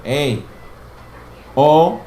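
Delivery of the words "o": O said alone, a bit louder; vowel sound modeled. Shown as a demonstration. Teacher answers her own question with the vowel. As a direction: give the O sound clearly. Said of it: "What sound under that?".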